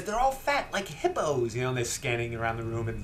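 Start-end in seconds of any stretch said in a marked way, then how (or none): 0.0-1.5 put-on much higher child voice, falling off; a kid blurting it out; little girl voice. Retelling the rest-stop scene, imitating the girl much higher. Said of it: "But she says".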